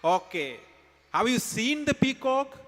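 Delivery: ordinary talk, nothing marked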